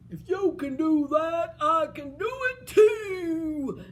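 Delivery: loud, slow giant boast. Acted. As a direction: bellow down the beanstalk. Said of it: "looked down there and said".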